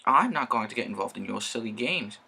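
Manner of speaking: usual speaking voice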